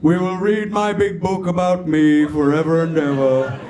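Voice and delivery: grand, intoning divine voice; traditional God. Acted. God promising eternity to a soul, grand intoning. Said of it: "You know, traditional heaven".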